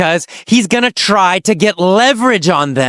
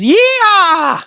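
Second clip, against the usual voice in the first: high, whooping celebration; excited cheer; 13 semitones higher than usual. Real, not acted. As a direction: cheer as if the finish line is crossed. Cheering the end of the lessons.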